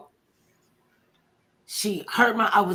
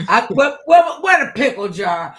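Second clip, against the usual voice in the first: loud, high mock-alarmed question; flustered calling out; 7 semitones higher, 8 dB louder than usual. Real, not acted. Host hunting for the cuss jar, flustered calling out.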